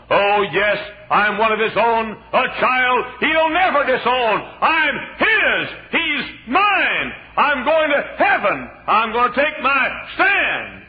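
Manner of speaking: loud, high, rising sermon cry; fiery preaching. Acted. Preacher booming the story of Obadiah to the church. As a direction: preach it out to the congregation at full force.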